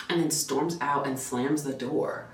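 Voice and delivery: normal talking voice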